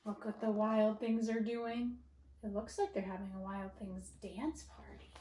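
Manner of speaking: lively read-aloud storytelling for first graders; reading to children. Acted. Teacher reading to her first graders, animated storytelling. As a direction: read it out like story time in class.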